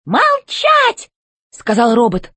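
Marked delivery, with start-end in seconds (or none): 0.1-1.1 high, sharp robot command; robot chef order. A narrator's reading. Robot chef silencing Alice, high command. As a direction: cut them off from high above. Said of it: "и он повторил".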